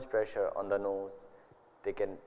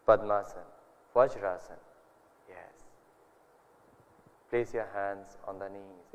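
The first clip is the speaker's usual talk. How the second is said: guided meditation; 3 dB quieter than usual. Acted. Leads into meditation.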